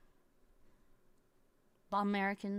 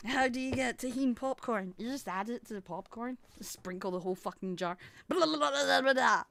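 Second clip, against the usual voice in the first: normal talking voice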